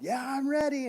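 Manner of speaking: shouting back from beside the road; hollered answer. Retold or imitated. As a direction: call out loud so dad hears. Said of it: "So I hollered".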